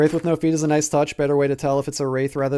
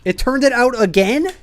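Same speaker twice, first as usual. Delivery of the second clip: higher, louder incredulous question; outraged disbelief at the ghost; 8 semitones higher, 6 dB louder than usual. Real, not acted. Ghost turns light off again.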